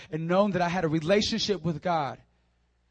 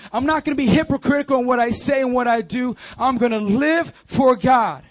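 loud, raised-pitch pulpit exhortation; impassioned sermon at full force; fervent preaching; 4 semitones higher, 6 dB louder than usual. Real, not acted. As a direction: drive each declaration home to the congregation.

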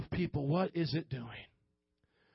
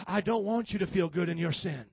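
ordinary delivery

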